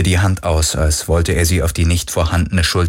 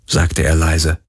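ordinary delivery

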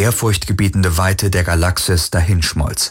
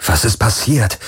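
ordinary delivery

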